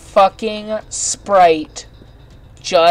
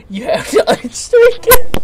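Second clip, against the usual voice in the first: laughing while talking; words through a laugh; 6 dB louder than usual. Real, not acted. Laughing at absurd item.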